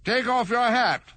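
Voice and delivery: high royal command; bossy king. Acted. King voiced.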